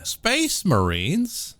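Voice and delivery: slow, high disbelieving "Space Marines?"; drawn-out excited surprise; surprised question. Real, not acted. Reacts to game mentioning Space Marines.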